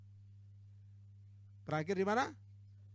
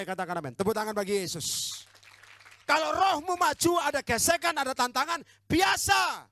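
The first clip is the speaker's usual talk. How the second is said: loud, high, emphatic exhortation; full-force preaching; 9 semitones higher, 17 dB louder than usual. Real, not acted. Preacher rallying church for a response, full-force preaching. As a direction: shout it to rouse the congregation.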